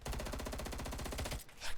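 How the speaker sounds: slow quiet whisper. Real, not acted. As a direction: breathe it under your breath. Whispered aside.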